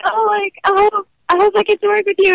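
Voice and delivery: high, lightly excited imitation of her younger self; mock-eager hopeful plea; retold hopeful gush. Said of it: "And I was like".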